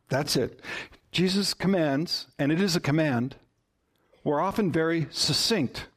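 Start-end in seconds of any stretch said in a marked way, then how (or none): none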